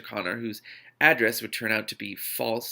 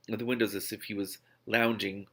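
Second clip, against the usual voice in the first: ordinary delivery, nothing marked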